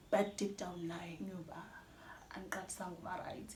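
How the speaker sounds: unvoiced whisper, confiding. Real, not acted. Vlogger confiding her hidden pain to viewers, whispered. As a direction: breathe it like a secret.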